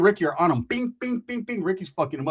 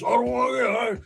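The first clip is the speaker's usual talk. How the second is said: higher, wobbly imitation; mock wobbly stoner; 6 semitones higher than usual. Imitating the friend balancing himself, higher. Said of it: "especially when they're saying it like this".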